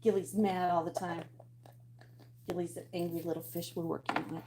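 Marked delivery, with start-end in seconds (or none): none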